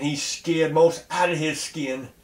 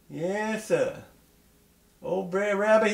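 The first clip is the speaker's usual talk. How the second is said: plain reading voice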